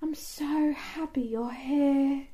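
slow, breathy imitation of an actress; breathy, drawn-out mock-British gush. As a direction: let it out like a last exhale. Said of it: "It's so breathy".

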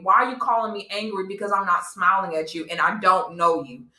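usual speaking voice

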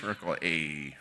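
Ai said like Fonzie's "aaay"; Ai as the Fonzie "ayyy". Shown as a demonstration. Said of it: "pronounce it the way Fonzie did in Happy Days".

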